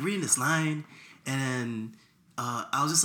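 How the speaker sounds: usual speaking voice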